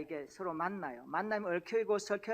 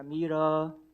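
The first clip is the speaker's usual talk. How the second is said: foreigner's reply; 2 semitones lower, 7 dB louder, much slower than usual. Retold or imitated. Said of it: "이렇게 대답했답니다".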